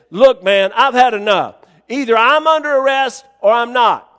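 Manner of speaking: loud, emphatic demand; retold fed-up ultimatum. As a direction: put your foot down with the officer.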